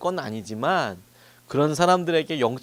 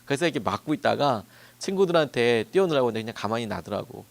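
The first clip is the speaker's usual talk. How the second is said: normal talking voice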